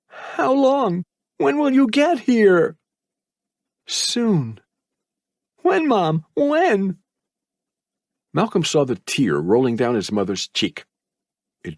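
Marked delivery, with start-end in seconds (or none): 0.1-7.0 high, slow, urgent questions; pleading boy. A narrator's reading. Son begs his mother to say when. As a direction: press her for an answer.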